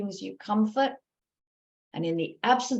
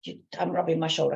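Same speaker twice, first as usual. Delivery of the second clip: ordinary talk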